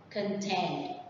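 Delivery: "Contain" softly, stressed on -TAIN; conTAIN, falling at the end; stress on second syllable. Shown as a demonstration. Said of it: "the stress is on the second one".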